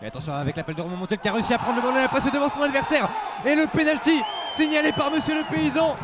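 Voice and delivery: shouted goal call; excited sports commentary. Real, not acted. Penalty call, fast.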